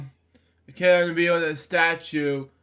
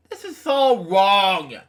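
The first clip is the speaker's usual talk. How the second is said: drawn-out, louder protest; indignant; 3 semitones higher, 5 dB louder, slower than usual. Real, not acted. Reader breaks off to protest the story, indignant. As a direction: object slowly and loudly, stretching the words.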